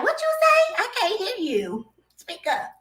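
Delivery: very high-pitched mock straining to hear; can't-hear-you complaint. Complaining to a whispering partner, high-pitched exasperation. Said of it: "But she whispered".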